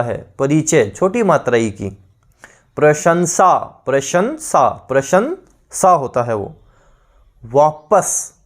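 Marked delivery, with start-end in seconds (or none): 2.8-5.9 slow syllable demo. Shown as a demonstration. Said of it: "revision demo".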